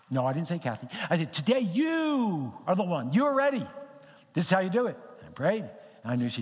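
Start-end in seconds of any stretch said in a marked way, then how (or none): 1.3-5.0 single her out, high and emphatic; emphatic retold call. Preacher retelling his call to Kathy in service, emphatic.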